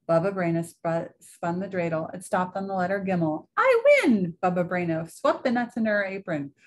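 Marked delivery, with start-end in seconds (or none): 3.6-4.4 high, loud gleeful cry; triumphant win. Acted. Baba Brana wins the dreidel game, gleeful high shout. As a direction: crow with delight at winning.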